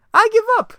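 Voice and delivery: very high, loud surrender; Joker voice. Acted. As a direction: give up in a squealing villain voice.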